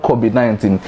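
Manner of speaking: "covid nineteen" said "cobid nineteen"; mispronounced covid. Shown as a demonstration. Teacher rejecting second error, "covid nineteen" as "cobid nineteen". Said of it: "it's not covid nineteen".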